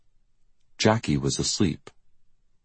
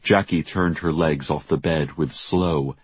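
plain reading voice

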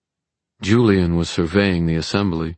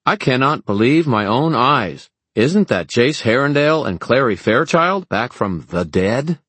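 loud and bored, higher voice; 8 semitones higher, 5 dB louder than usual. Acted. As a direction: announce it loudly, thoroughly unimpressed. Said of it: "in a loud, bored voice".

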